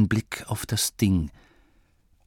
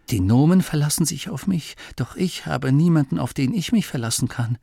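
higher, hurried lament; Masklin, self-pitying; 7 semitones higher, faster than usual. Acted. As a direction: complain to yourself, feeling put upon. (selbstmitleidig klagend)

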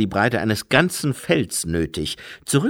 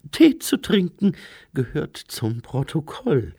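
Lady Lejeune; 5 semitones higher than usual. Acted.

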